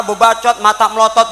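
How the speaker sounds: ordinary talk, nothing marked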